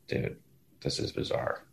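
unvoiced whisper of disbelief; whispered aside. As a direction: breathe it as a secret aside. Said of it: "it was like".

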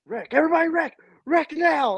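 high, loud yell for a wreck; retold yelling. Fan yelling at the TV for a wreck. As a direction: shout at the screen for a crash. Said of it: "I was like".

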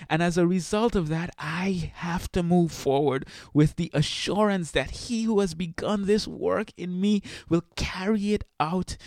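high, fervent testimony; preaching testimony. Real, not acted. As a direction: testify with conviction. Testimony of faith.

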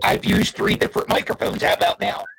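distorted, glitchy call audio; robot voice. Real, not acted. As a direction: voice breaking up over a bad connection. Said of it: "do they not get internet in Bridesburg".

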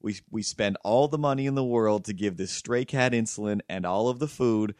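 ordinary talk

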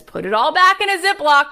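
high and loud husband's reminder. Retold or imitated. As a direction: bark the house rule like the husband.

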